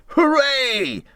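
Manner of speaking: high, loud hooray; cheering. A narrator's reading. Rat cheers on finding something in the snow. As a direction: cheer it out in triumph. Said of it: "Suddenly the rat cried".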